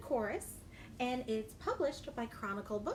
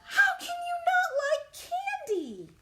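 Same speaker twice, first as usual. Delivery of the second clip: high, loud astonished cry; disbelief; 6 dB louder than usual. Acted. Reader acting disbelief that Little Pea hates candy. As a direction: act shocked and outraged.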